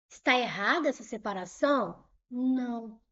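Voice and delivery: ordinary talk, nothing marked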